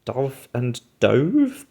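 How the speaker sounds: slow "Dove and dove," as the bird, then rhyming with stove; drawn-out contrast of "Dove and dove,"; two pronunciations. Shown as a demonstration. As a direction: say "Dove and dove," as duv, then dohv. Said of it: "they've just got two different pronunciations".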